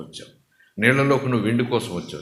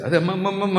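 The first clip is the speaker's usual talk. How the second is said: higher, urgent plea, ma'am, ma'am; pleading vendor; 7 semitones higher, faster than usual. Retold or imitated. Vendor begging the woman to stop, higher pleading. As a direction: stop her grabbing more, pleading.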